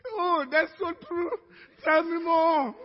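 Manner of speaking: high, loud gushing agreement; hammed-up rapt listener; mock eagerness. Worrier in bed lapping up Satan's ministry, mock eager.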